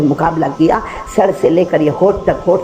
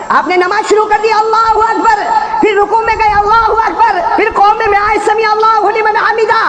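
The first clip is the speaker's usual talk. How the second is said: preaching at full force. Acted. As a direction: cry it out to the crowd.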